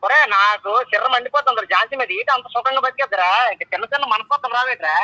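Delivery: loud, annoyed scolding down the phone; angry. Real, not acted. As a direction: vent at the friend who left his wife. Angry friend on phone call. (కోపంగా గట్టిగా తిడుతూ)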